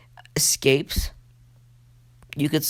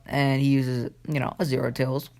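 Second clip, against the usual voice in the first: usual speaking voice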